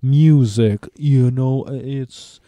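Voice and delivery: very slow, drawn-out drummer impression. Shown as a demonstration. Imitates Metallica's drummer on Napster, drawling impression. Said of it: "do that impression before".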